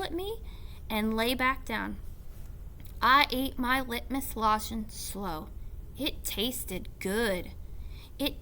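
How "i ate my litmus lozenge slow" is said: ordinary delivery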